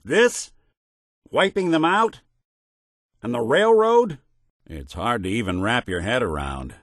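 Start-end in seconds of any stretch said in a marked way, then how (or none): 0.0-4.2 louder, high-pitched incredulous questions; incredulous, voice pitched right up; appalled disbelief. Acted. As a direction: question it in rising outrage. Appalled at factions being destroyed.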